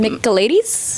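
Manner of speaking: Michaelides as mick-uh-LAY-deez, slowly and rising; slow questioning Michaelides, with LAY in the middle; tentative pronunciation. Shown as a demonstration. Co-host guessing the author's surname, tentative Michaelides with LAY.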